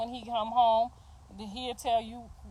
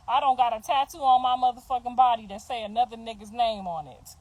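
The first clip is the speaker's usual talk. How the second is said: normal talking voice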